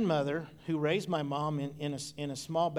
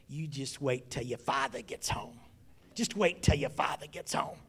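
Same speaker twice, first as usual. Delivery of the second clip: mom's threat; 3 semitones lower than usual. Retold or imitated. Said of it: "and then she'd say".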